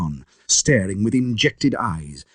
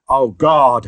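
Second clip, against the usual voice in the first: terrified scream; 8 dB louder than usual. A narrator's reading. Lanyon screaming at the sight of Jekyll. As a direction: scream it out in terror. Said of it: "I screamed".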